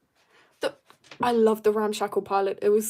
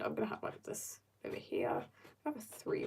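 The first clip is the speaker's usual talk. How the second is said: whisper; 11 dB quieter than usual. Real, not acted. Muttering to self while drawing.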